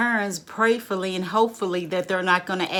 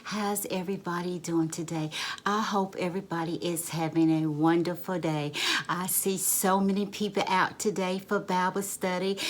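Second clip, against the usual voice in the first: Pastor Marcia, gracious; 5 dB quieter than usual. Acted. Pastor opening Bible study, gracious and calm. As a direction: greet the congregation gently and unhurriedly.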